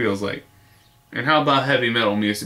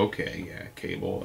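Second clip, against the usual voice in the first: low, quiet, bored mumble; unimpressed shrug; 8 semitones lower, 12 dB quieter than usual. Retold or imitated. Today's viewer shrugging at cable, a low bored mumble. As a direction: shrug it off under your breath. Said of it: "today you look at cable and go".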